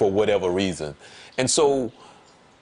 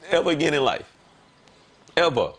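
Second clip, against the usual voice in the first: ordinary delivery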